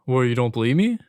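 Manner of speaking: loud, offended retort; mock angry customer. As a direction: throw it back like an insulted customer. Acted quarrel with angry customer.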